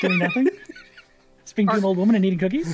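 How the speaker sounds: high, loud, fast disbelief; incredulous. Acted. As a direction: scoff at the idea, rapid and high. GM mocks the level-up request.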